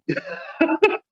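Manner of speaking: short high breathy laugh; a quick embarrassed laugh at himself; light laugh at own expense. Real, not acted. Laughs at own bad poetry.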